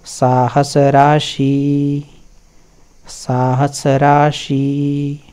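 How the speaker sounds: very slow साहस राशि, each syllable stretched; slow recitation. Shown as a demonstration. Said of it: "यही तो बोलना है हमने".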